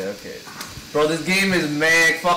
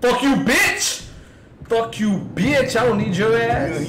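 yelling angrily; 7 semitones higher, slower than usual. Real, not acted. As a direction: shout insults at the monster. Yelling at monster.

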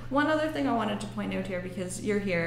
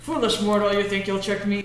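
louder, hammed-up villain voice; booming demon lord; 6 dB louder than usual. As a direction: taunt like a cartoon demon. Mock villain taunt during trick.

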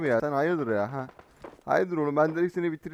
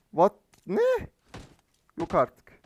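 usual speaking voice

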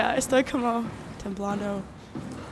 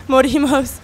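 scared yelp; 3 semitones higher, 11 dB louder, slower than usual. Real, not acted. Dying again in the game.

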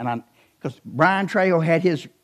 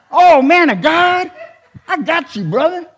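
"Oh, man of God" drawn out slow, higher and louder; 12 semitones higher, 10 dB louder, slower than usual. Retold or imitated. Preacher imitating the rapper's man greeting him. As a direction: ham up the entourage guy's greeting. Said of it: "that's how they talk".

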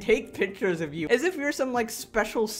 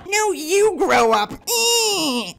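shrieked comeback, much higher and louder, slow; hammed-up imitation of her yelling; mock scream; 11 semitones higher, 9 dB louder, much slower than usual. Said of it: "mocking the woman".

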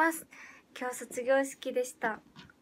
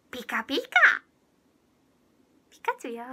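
Pikachu impression; 6 dB louder, faster than usual. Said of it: "でもピカチュウはしてみた".

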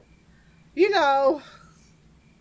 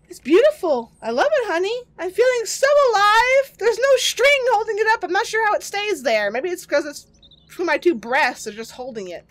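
high, louder wife impression; mock wife voice; 8 semitones higher, 5 dB louder than usual. Gamer voicing wife Mary about the amulet, high and giddy. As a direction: play the enchanted wife, giddy.

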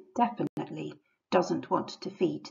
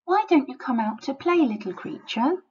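sly coaxing wolf, pitched up; 7 semitones higher than usual. A narrator's reading. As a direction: coax like a wolf at the door. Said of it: "asks the wolf".

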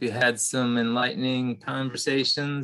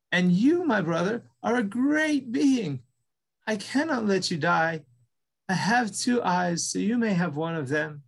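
higher, trembling little mouse; shaky voice; 7 semitones higher than usual. Acted. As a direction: brave but trembling offer. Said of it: "he said with a shaky voice".

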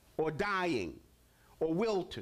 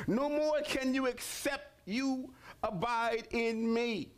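slow, solemn Bible reading; measured pulpit reading; scripture reading; slower than usual. Acted. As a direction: read the words of Jesus with weight.

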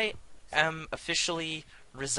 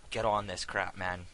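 ordinary talk, nothing marked

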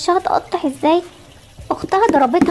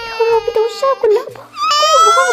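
shocked wail; 8 semitones higher than usual. Acted. Mother seeing the spice on her daughter's face, crying out. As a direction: wail at the sight, half laughing.

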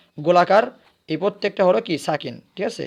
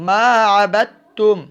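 slow, drawn-out melodic recitation, a little higher and louder; Quranic recitation; 4 semitones higher, 6 dB louder, much slower than usual. Shown as a demonstration. Teacher demonstrating the correct reading, slow melodic chant. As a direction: stretch it out as a tajweed chant. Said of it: "আমরা বলবো".

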